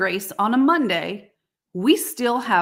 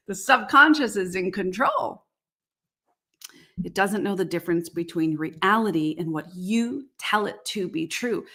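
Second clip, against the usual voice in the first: ordinary talk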